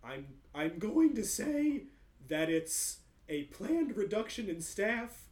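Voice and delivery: entranced clerk reciting corporate lines; zoned out. Acted. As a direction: recite it like a mindless puppet. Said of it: "zoned out, like, neutered version of [name]'s voice".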